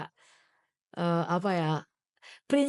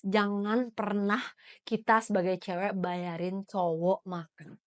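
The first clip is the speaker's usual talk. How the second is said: slow, softer and deliberate; measured restatement; 2 semitones lower, 4 dB quieter, slower than usual. Real, not acted. Host clarifying the rule for women listeners, measured. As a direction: spell the rule out slowly, toned down.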